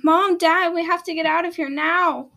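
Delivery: urgent, high, loud cry to parents; shouting. A narrator's reading. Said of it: "She started shouting".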